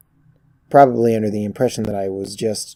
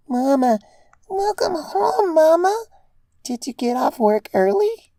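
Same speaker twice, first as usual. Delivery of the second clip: put-on very high, bright child's voice calling out; a small child thrilled her mother is home; squeaky little girl overjoyed; overjoyed little filly. A narrator's reading. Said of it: "Little Dinky cried out, overjoyed".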